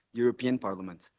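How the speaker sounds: ordinary talk, nothing marked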